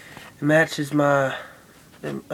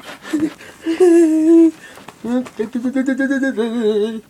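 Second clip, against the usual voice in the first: cracking up high and loud; real loud laugh at himself; loud high burst of laughter; 11 semitones higher, 9 dB louder than usual. Real, not acted. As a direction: let a big giggling laugh burst out. Laughs at his own attempt.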